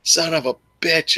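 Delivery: high exasperated curse; mock curse. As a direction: swear at the chart in frustration. Cursing the falling stock.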